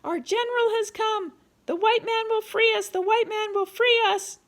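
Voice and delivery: high, loud cries of a rescued captive; excited yelling. A narrator's reading. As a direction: yell it out to the whole line of prisoners. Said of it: "started yelling".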